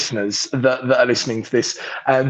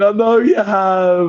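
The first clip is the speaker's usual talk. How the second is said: smug reply; 5 semitones higher, 3 dB louder, slower than usual. Retold or imitated. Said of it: "like that".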